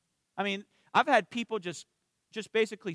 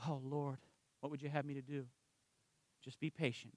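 softer, lower hushed prayer dialogue; 6 semitones lower, 14 dB quieter than usual. Retold or imitated. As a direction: murmur a prayer to God, lower. Praying to the Lord.

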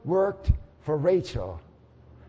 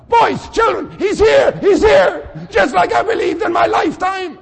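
high, loud joyful shout; excited shout; 13 semitones higher, 10 dB louder than usual. Pretend. Risen father shouting joyfully to his children. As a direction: cry out that he has come. Father sees Jesus coming.

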